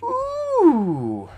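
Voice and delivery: high, long falling "ooh"; impressed ooh. Real, not acted. GM reacting to psychic damage, high falling ooh. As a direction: coo a drawn-out high ooh.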